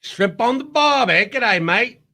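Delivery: high, drawn-out mock Australian accent. Shown as a demonstration. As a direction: put on a broad, hammy Australian accent. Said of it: "Australian accent".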